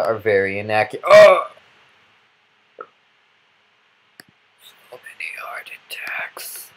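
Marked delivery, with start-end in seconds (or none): 1.1-1.5 sudden loud, high yelp; startled yell. Real, not acted. Player jolted by a game hazard, startled yell. 2.8-6.7 slow, voiceless whisper; weak whisper. Real, not acted. Player after too many heart attacks, weak whisper. Recovering after a game scare.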